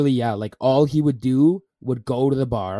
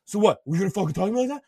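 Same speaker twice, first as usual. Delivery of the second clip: higher-pitched combative retort; mock angry; 8 semitones higher, faster than usual. Angry drunk answering the friend telling him off, mock combative. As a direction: imitate a drunk who won't back down. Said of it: "getting that angry".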